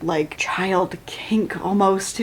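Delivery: slow whispered aside; slow whispered discomfort; grossed-out whisper. Real, not acted. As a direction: whisper it slowly, uncomfortable. Uncomfortable aside.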